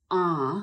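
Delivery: A as a long, low "ah". Shown as a demonstration. Teacher shows kinds of English A, long open "ah". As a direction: hold A open as in office. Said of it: "Długie A".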